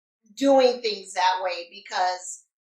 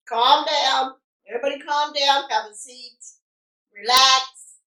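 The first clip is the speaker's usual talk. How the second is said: raised-voice "settle down" commands; mock calming orders; 2 semitones higher, 7 dB louder than usual. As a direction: playfully order everyone to settle. Mock settling of an angry man.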